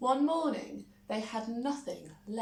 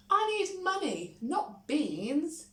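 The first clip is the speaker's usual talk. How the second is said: high, louder protest; indignant Jack; 10 semitones higher, 5 dB louder than usual. A narrator's reading. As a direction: push back like an offended boy. Jack refuses the beans.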